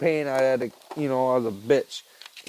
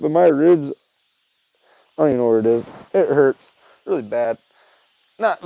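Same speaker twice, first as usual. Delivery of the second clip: usual speaking voice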